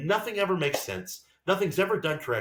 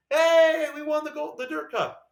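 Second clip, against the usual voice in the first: much higher, loud sarcastic mock cheer; sarcastic announcer voice, starting loud and much higher; 12 semitones higher, 5 dB louder, slower than usual. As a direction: parody an official proudly announcing, high-pitched. Mocking officials' announcement.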